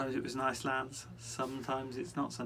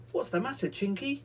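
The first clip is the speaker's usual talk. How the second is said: high, surprised question; surprised children; 9 semitones higher, 4 dB louder than usual. Acted. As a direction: ask it as startled children. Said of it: "said the children in surprise".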